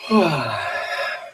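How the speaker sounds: long yawning sigh, dropping away; open-mouthed yawn ending in a sigh; demo yawn. Shown as a demonstration. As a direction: let a real yawn roll out with abandon. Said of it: "you will start feeling like yawning".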